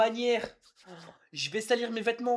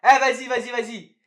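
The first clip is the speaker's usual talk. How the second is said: loud, high outburst of frustration; exasperated shout; 4 semitones higher, 12 dB louder than usual. Real, not acted. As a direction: shout it out, fed up. Yells at the sticky dough.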